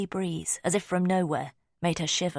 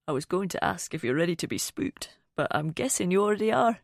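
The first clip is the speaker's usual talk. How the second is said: light Scottish accent with a hint of humour; gently teasing Scottish lilt; smooth Scottish accent. A narrator's reading. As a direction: play Finn as a smooth, amused Scotsman. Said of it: "His Scottish accent was smooth and held a hint of humour".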